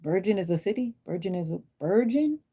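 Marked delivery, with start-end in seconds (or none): 1.8-2.4 puzzled. Real, not acted. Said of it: "What you mean, Virgin?".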